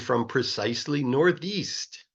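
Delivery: usual speaking voice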